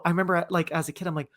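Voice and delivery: ordinary delivery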